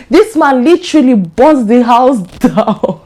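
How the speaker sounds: incredulous, laugh breaking in at the end; emphatic, tipping into laughter; laughing disbelief. Real, not acted. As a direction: say it in disbelief, cracking up. Breaks up at absurd story turn.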